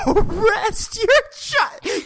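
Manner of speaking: very high, slow, laughing squeal; laughing disbelief. Real, not acted. Podcaster cracking up at arresting a child, high and slow.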